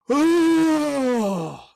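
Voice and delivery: high, loud ghostly "Oh"; cartoon ghost moan. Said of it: "It sounded like a cartoon ghost".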